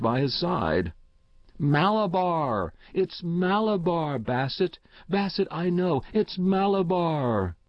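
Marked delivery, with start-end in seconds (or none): 1.6-7.6 put-on high, urgent child's cries; feverish crying out. A narrator's reading. Delirious child calls to Bassett from his sickbed, crying out. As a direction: cry it out high, drawing out the name. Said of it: "So the child cried".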